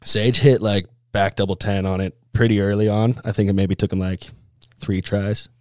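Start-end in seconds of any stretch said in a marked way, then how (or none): none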